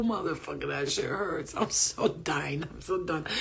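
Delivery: ordinary talk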